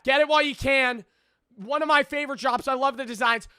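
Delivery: high-pitched promo pitch; hyped merch ad. Real, not acted. Streamer urging viewers to buy his merch, hyped. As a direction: push the drop with eager energy.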